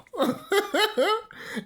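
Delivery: bursting high laugh. Real, not acted. Vlogger laughing at own insult, high laugh. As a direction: break into laughter.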